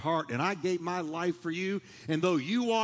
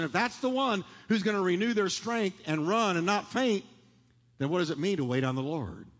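ordinary delivery

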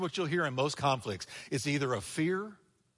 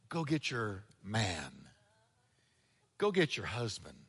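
Jesus, low and soft; 8 semitones lower, 5 dB quieter than usual. Retold or imitated. Jesus quoted, low and slow.

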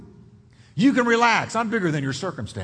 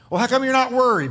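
quick, higher, louder challenge; probing skeptic; 5 semitones higher, 4 dB louder, faster than usual. Acted. Skeptic challenging the calm believer, probing and quick. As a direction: push the question at them.

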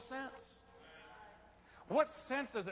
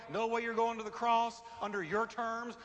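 normal talking voice

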